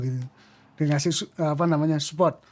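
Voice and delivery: ordinary talk, nothing marked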